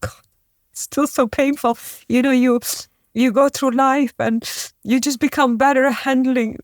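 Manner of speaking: choking up while telling it; real tears breaking through; crying. Real, not acted. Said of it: "So forgive me if I'm crying".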